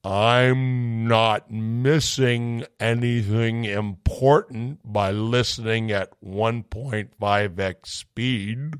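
very slow, drawn-out mock delivery; said real slow. Shown as a demonstration. Host mocking a listener by saying it real slow. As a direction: stretch every word as if at slow speed. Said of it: "here, I'll say it real slow".

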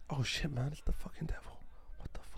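hushed, frightened whisper; scared whisper. Pretend. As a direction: whisper it in shock. Scared reply to the devil.

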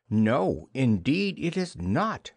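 emphatic denial, "No" hit hard; frightful emphasis. A narrator's reading. Wendy answers Peter about Tiger Lily, very emphatic. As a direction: come down firmly on "No" and "not". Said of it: "replied with frightful emphasis".

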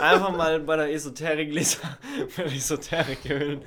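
laughing through the words. Real, not acted. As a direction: giggle while talking. Laughing while joking.

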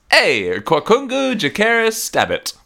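loud, high hammed-up Latin accent; mock Latin accent. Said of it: "In a Latin accent, please".

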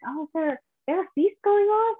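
high, rising mock question; curious stranger. Imitates curious strangers asking.

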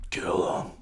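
sudden hushed urge; hushed urging. Retold or imitated. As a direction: drop to a low hush. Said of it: "coming up behind him going".

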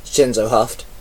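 usual speaking voice

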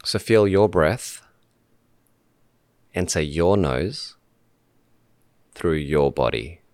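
calm meditation leader, long pauses between short phrases; unhurried guidance, letting each phrase settle in silence; guided meditation. Real, not acted. Host guiding podcast guests to sit and breathe, calm meditation voice.

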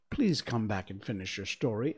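usual speaking voice

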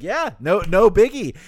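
high, loud, cheery dismissal; play-acted carefree yes; breezy agreement. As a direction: imitate an eager kid shrugging it off. Voicing kid's breezy agreement.

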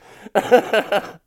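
loud high burst of laughter; big laugh. Real, not acted. Laughing at bird fight.

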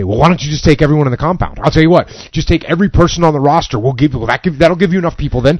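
loud, fast, sarcastic overkill offer; mocking overkill. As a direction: blow the request up out of all proportion, loudly. Boss mocking by exaggerating request.

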